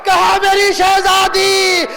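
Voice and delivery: full-force majlis oration with long-held words; impassioned lament preaching. Real, not acted. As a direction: wail the dialogue out to the crowd.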